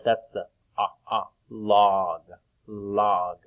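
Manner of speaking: log with an open ah vowel; short o in log. Shown as a demonstration. Teacher sounding out log for children, ah vowel. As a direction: stretch the ah in log. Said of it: "I hear the ah sound".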